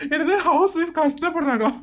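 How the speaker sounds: scornful laugh into a high incredulous question; mocking laughter. Husband laughing off the interviewer's question about housewives' hardship. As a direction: laugh off the very idea. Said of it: "இப்படி சிரிக்கிறீங்க".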